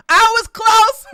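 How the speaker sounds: high, loud, excited squeal; crowing over a near miss; gleeful squeal. Real, not acted.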